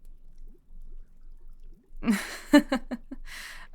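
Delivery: long airy giggle rising in pitch; light teasing laugh; amused giggle. Acted. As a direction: giggle at their confusion. Amused by the listener's confusion.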